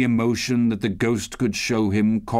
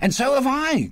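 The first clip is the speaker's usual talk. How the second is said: put-on high-pitched boyish agreement; Peter's keen exclamation; eager cry. A narrator's reading. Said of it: "cried Peter".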